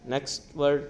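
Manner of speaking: normal talking voice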